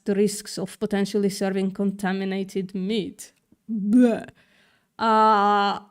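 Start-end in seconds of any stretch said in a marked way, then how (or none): 3.7-4.3 short, loud noise of revulsion; disgusted bleh. Real, not acted. Streamer reacting to contaminated meat on the menu, disgusted bleh. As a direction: blurt out a loud yuck sound.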